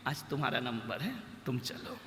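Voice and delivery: low, soft, fast, half-whispered; quiet summons. Acted. Yamraj telling the seth his time has come, hushed. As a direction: drop to a hush for the verdict.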